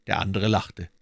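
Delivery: ordinary delivery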